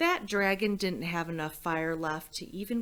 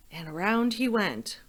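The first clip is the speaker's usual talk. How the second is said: ordinary delivery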